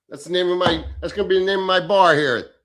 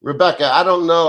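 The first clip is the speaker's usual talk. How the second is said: louder, cutting in on the name; loud burst; 8 dB louder than usual. Real, not acted. To viewer Rebecca, a loud startled cut-in. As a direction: blurt it out to stop the idea.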